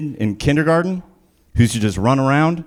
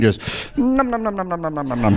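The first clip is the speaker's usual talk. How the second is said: mock nomming; 4 semitones higher, 4 dB quieter than usual. Mock eating sound.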